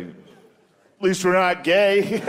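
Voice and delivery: slow, smug, laughing jab; smug comeback. Retold or imitated. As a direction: deliver the insult with smug, slow relish. Voicing an American retort.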